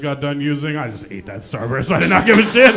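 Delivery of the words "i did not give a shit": ordinary talk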